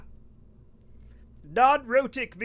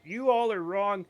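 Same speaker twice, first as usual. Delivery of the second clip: ordinary delivery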